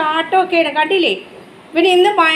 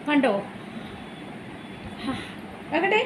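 ordinary delivery

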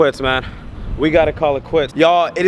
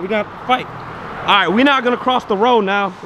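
ordinary delivery

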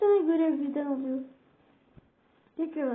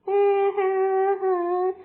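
slow, drawn-out villain chuckle; mock evil laugh; 3 semitones higher, 4 dB louder, much slower than usual. Streamer taunting enemy with slow mock villain laugh. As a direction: cackle slowly like a cartoon villain.

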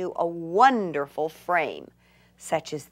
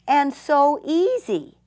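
higher and louder, slowed with delight; 8 semitones higher, 6 dB louder than usual. Real, not acted. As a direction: beam as you stretch it out. Delighted by her craft.

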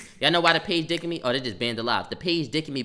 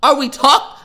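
disbelieving yell; 10 semitones higher, 11 dB louder than usual. Retold or imitated. Telling stream how he yelled at the principal, shrieking. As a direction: yell it, much higher and louder, rising to a shriek. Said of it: "I'm, I'm like".

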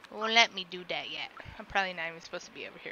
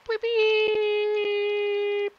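high, loud, held beep; long steady electronic-style beep; imitated beeping; 12 semitones higher, 8 dB louder than usual. Player sounding out the elevator, high sustained beeping.

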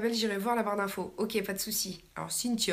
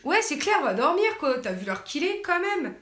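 higher, louder telling-off; scolding; 6 semitones higher, 4 dB louder than usual. Real, not acted. Streamer loudly scolding a fly buzzing around her. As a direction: shoo the pest away, exasperated.

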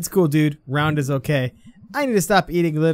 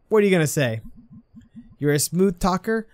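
usual speaking voice

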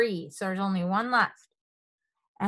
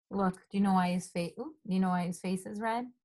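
ordinary delivery, nothing marked

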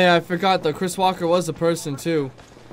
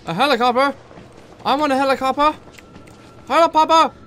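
high, loud, building to a shriek; excited shouting; 11 semitones higher, 10 dB louder than usual. Real, not acted. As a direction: yell it out, thrilled.

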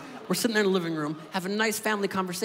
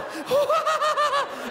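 very high shrieking giggle; hammed-up high giggling dad; stoned dad cackle; 4 dB louder than usual. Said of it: "Here is exactly how my dad walks in the house".

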